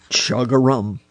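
put-on old frog's gruff croaked call; deep, gruff voice. A narrator's reading. Grandfather Frog interrupting the quarrel, deep gruff voice. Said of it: "gruffest voice".

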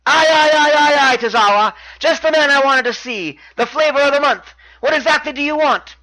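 high, loud-starting put-on welcome; Neville, mock glad. Champion greets his arriving challenger, high mock-glad promo voice. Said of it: "Neville acts glad to see him".

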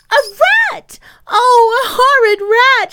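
put-on very high, loud child's shriek. A narrator's reading. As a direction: shriek in horror, pitched right up. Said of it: "shrieked the child".

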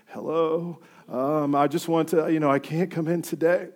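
put-on weak, pitiful phone voice; faking illness on a call to work, lightly pitiful; fake sick voice. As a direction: sound sick to the boss on the phone. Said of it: "And then you're like".

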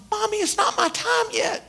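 put-on high, soft little-kid voice; child's voice. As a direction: pipe it up like a small child. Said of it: "he was a child".

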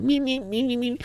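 very high squeaky alien chatter; alien gibberish. Retold or imitated. Little green and gray dudes talking, alien gibberish. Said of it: "talking like I couldn't understand that shit".

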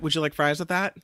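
flat, rote drive-thru upsell; bored fast food cashier. Retold or imitated. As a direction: recite it like a tired cashier. Mock McDonald's cashier upsell line.

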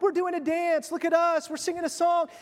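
put-on high-pitched little kids showing off; mock childish calling for attention; attention-seeking children. Children in the marketplace calling out, high childish showing off. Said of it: "like children singing in the marketplace who call out".

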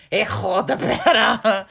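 hammed-up Russian-sounding hijo de perra, slow and laughing; mock Russian accent. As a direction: play it like a fake Russian tough guy, drawn out. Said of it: "Scandinavian or Russian".

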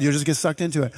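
ordinary talk, nothing marked

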